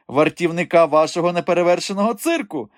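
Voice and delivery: high, assured announcement; self-assured, animated reply; confident. A narrator's reading. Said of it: "впевнено відповів Голий".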